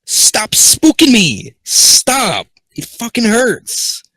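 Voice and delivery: loud, high, slow yell; loud complaint. Real, not acted. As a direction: shout it out, still shaken. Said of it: "I got spooked".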